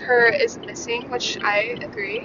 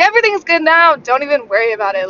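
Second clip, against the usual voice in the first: relieved reassurance; 4 semitones higher, 7 dB louder than usual. Retold or imitated. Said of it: "I was like".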